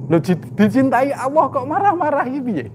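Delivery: high, loud voice of a baffled listener protesting; puzzled objector. Acted. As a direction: play the doubter who can't believe it.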